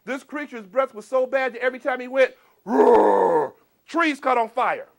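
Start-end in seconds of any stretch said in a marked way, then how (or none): 2.6-3.5 loud growling roar. Acted. Storyteller voicing the creature, loud roar. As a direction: roar like a fire-breathing beast.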